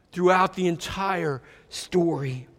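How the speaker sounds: normal talking voice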